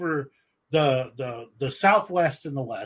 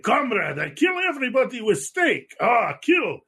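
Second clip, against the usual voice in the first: high, hammed-up Russian accent; 8 semitones higher than usual. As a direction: play a cartoon Russian tough guy, pitched high. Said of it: "nineties Russian or eighties Russian".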